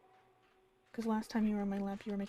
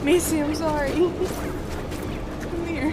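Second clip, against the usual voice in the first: louder, higher, laughing through apologies; laughing apology; 5 semitones higher, 10 dB louder than usual. Real, not acted. Streamer apologizing to Macy the dog, laughing loudly.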